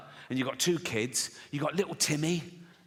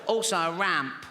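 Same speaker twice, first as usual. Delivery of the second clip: put-on comic child voice asking for more; Timmy voice; 2 semitones higher than usual. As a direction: slip back into little Timmy. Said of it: "do the voice".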